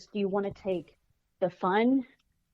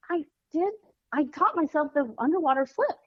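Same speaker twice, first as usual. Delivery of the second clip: excited daughter; 7 semitones higher than usual. Retold or imitated. Daughter voiced in story.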